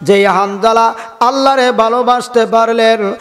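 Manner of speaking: usual speaking voice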